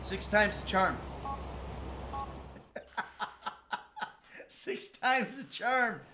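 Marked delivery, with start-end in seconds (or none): 1.0-3.5 soft breathy disbelieving laugh; bitter chuckle; rueful laugh. Real, not acted. 4.5-6.0 slow, higher drawn-out line; wry slow repeat; slow ironic drawl. Real, not acted.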